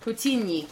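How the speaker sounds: tentative Russian-style "Putini" ending in nyee; attempted pronunciation. Shown as a demonstration. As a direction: release the n of "Putini" into a light yee. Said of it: "It's kind of like".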